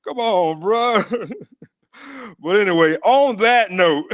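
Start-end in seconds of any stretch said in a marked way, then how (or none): 3.0-4.1 laughing. Real, not acted. Laughing while signing off.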